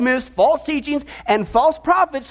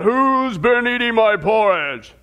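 a hint of a big Papa Bear roaring; slower than usual. A narrator's reading. Pastor telling the story, lightly voiced roaring papa bear. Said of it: "And so he roars out".

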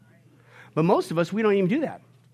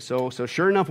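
usual speaking voice

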